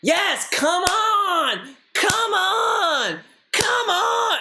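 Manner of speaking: very high, long-drawn crowd yell; mock congregation hollering. Imitating churchgoers cutting off the pastor, mock hollering. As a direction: stretch it out like an overexcited churchgoer. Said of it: "and they go".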